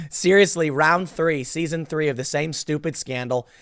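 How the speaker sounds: ordinary delivery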